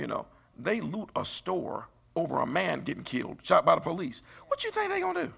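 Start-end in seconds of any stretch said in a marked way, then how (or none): 4.5-5.3 high mocking squeak of a question; mock high squeal. Preacher teasing the doubters, in a squeaky mock voice. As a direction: toss it up in a silly squeal.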